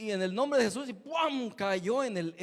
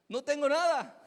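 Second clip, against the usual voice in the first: higher, louder cry of joy; joyful reveal; 7 semitones higher, 9 dB louder than usual. Retold or imitated. Healed man to the preacher, joyful cry. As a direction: show off the healing.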